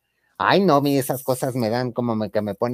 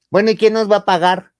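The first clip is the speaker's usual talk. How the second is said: mock demanding woman; 7 semitones higher, 7 dB louder than usual.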